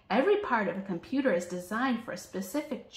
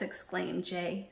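plain reading voice

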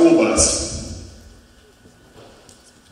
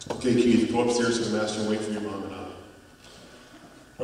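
lower, much softer father's instruction; quiet, measured fatherly order; dad quietly sending Keith up to the bedroom; calm dad; 4 semitones lower, 8 dB quieter than usual. Retold or imitated. Father sending son upstairs.

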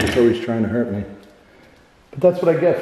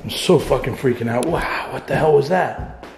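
ordinary talk

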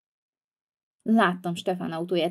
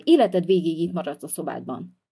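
usual speaking voice

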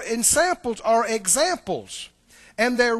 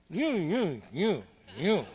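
mock mumble; 6 semitones lower, 5 dB quieter, slower than usual.

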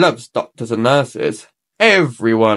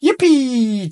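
put-on high excited cheer of children; a joyful little whoop; 9 semitones higher than usual. A narrator's reading. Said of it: "cheered the children".

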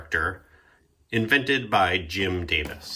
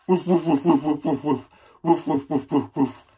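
louder, higher run of woofs; barking demo; 5 semitones higher, 4 dB louder than usual. Shown as a demonstration. Narrator teaching the puppy to bark, louder woofs. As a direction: show the puppy how to bark. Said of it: "it's like this".

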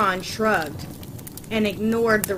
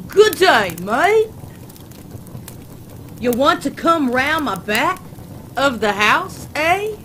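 loud, high, hammed-up fake Australian accent; bad Aussie accent; 5 semitones higher, 6 dB louder than usual. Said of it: "in a fucked up Aussie voice".

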